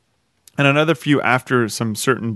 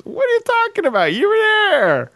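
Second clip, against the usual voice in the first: high-pitched hillbilly tow truck; Mater voice. Retold or imitated. Mater insisting to Lightning McQueen that he was there, high and goofy. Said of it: "He goes".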